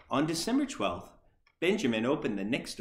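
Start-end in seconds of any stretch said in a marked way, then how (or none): none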